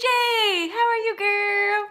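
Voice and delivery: high, loud, stretched-out hello; excited greeting. Real, not acted. Greeting Katie J as she joins, excited high call. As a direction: call out to a friend, drawing it out.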